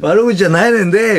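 high, loud insistent denial; loud protest. Retold or imitated. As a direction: insist loudly it wasn't mean. Protesting to Arii.

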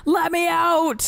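high, drawn-out cry; mock pleading yell. Playing trapped in a game, mock pleading yell. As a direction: call it out slowly in mock desperation.

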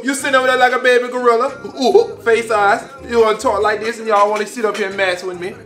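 ordinary talk

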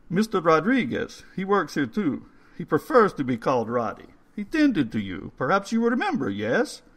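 put-on coaxing old man spooning soup. A narrator's reading. Old man's voice, held.

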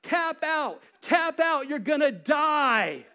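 building shout, tap out, tap out; mock ringside yell. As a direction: yell at the ring for him to give up. Cheering on wrestling match.

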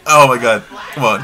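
ordinary talk

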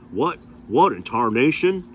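exclaiming. A narrator's reading. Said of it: "the tall man exclaimed".

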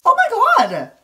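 very high loud shriek; shrieked oh my god. Real, not acted. Seeing his old teeth, a loud high shriek. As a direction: shriek in delighted shock.

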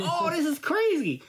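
very high, delighted disbelief; amazed reaction. Retold or imitated. Newcomer marvelling at a virtual world, amazed. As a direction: re-enact being blown away, voice soaring.

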